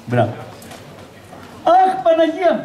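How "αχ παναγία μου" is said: high, put-on cry of grief; mock mourning wail. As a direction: act the grieving widow on demand. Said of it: "μπράβο ρε γυναίκα". (ψεύτικο κλάμα χήρας)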